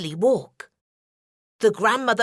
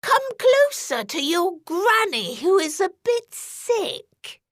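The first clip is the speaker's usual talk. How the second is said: higher fake old woman voice; wolf lying to the girl in a granny voice, higher; wolf faking granny; 7 semitones higher, slower than usual. A narrator's reading. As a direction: lie sweetly as a pretend granny.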